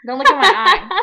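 suddenly loud and high; loud embarrassed protest. Real, not acted. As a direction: yelp it out, flustered. Embarrassed about lazy eye.

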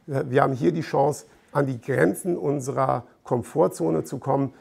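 normal talking voice

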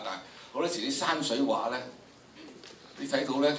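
ordinary talk, nothing marked

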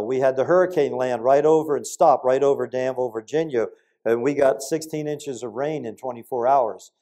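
ordinary talk, nothing marked

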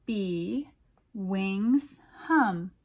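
slow, lilting picture-book reading; poem read aloud. A narrator's reading. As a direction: read it like a bedtime rhyme for kids.

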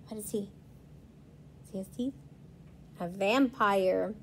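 plain reading voice

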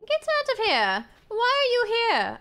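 high indignant diva shriek; mock outrage. Streamer shrieking at players watching her build, mock outrage. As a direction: shoo them off like an affronted starlet.

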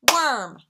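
"worm" as one loud, punched syllable; "worm" in one firm beat; single clapped beat. Shown as a demonstration. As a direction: clap once on "worm". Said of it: "That's just one".

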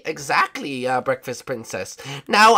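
ordinary delivery

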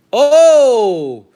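a sudden happy cry over a lucky pull; surprised exclamation. Real, not acted. Pulls a Griffey card.

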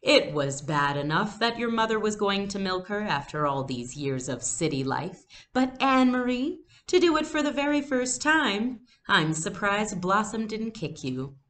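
a hint of Uncle Henrik teasing with a laugh; amused Uncle Henrik. A narrator's reading. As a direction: tease Anne-Marie fondly about the cow.

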